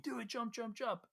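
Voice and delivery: ordinary talk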